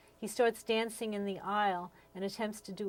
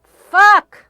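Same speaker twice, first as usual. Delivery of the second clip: sudden screamed curse, much higher and louder; loud outburst; 11 semitones higher, 24 dB louder than usual. Retold or imitated. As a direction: blurt it out at full volume. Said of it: "out loudly".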